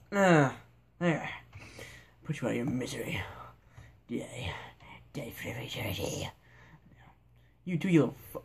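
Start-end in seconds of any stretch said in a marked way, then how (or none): 2.2-6.3 menacing whisper. Pretend. Finishing off a last enemy in the game, menacing whisper. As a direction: breathe the threat like a quiet killer.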